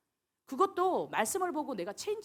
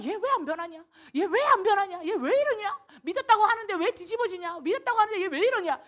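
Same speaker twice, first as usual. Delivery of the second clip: exasperated teacher; 8 semitones higher, 9 dB louder than usual. Retold or imitated. Teacher's frustrated thoughts voiced.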